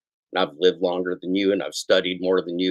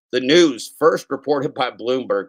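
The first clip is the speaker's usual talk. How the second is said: a bit louder newscaster read; news read; 3 semitones higher, 4 dB louder, slower than usual. Acted. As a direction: announce it like the news. Reads news item on air.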